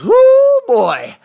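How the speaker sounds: high, loud whoo of excitement; a sudden loud cheer; excited whoop. Real, not acted. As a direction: let out a real whoop of joy. Excited about going back.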